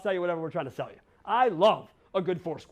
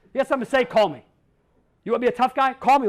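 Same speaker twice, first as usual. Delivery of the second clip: loud challenge; 5 semitones higher, 8 dB louder, faster than usual. Real, not acted. Challenging critics to phone him.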